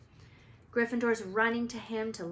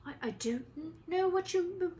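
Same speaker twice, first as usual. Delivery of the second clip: rising, higher panicked stutter; frightened stammer; 9 semitones higher than usual. Acted. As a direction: stumble up in fright. Quirrell stammers in fear.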